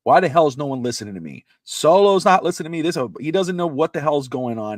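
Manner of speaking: angry yell. Retold or imitated. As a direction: belt it out like a furious manager losing control. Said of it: "He screams".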